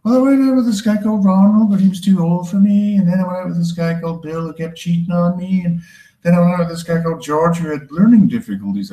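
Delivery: high-pitched, whiny mock-female monologue; woman recounting bad boyfriends. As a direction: play a hapless girlfriend listing exes, voice up high. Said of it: "a woman who keeps choosing the wrong boyfriend".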